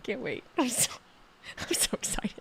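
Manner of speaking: whispered, barely voiced thrill; excited whisper. Real, not acted. Streamer whispering to chat how excited she is. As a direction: hush it like a secret you can't hold in. Hushed excitement about Monday.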